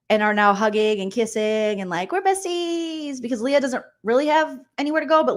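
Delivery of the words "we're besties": high, long-drawn singsong; mock gushing besties. Recapper mimicking hugging housemates, high mock gushing. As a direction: squeal it sweetly, stretching the end. Mocking gushy friendship.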